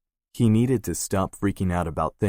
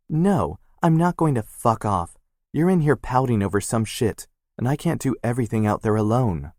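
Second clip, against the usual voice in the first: higher, louder heated protest; angry retort; 5 semitones higher, 4 dB louder than usual. Acted. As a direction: push back hard at him. Sister argues back angrily.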